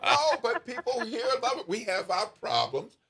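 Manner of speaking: high-pitched protest through laughter; laughing protest. Retold or imitated. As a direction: insist while cracking up. Retold protest amid laughter.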